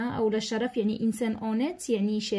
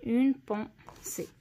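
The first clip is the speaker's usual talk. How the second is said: "اون بونسي" slowly, each syllable set apart; slow dictation; slower than usual. Shown as a demonstration. Teacher checking the written word for pupils, slow syllabled "اون بونسي". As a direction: separate "اون", "بون" and "سي" with small gaps.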